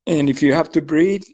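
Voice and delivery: calm, paced breathing instructions; guided breathwork. Acted. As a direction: guide the group through each breath. Leads a breathing exercise.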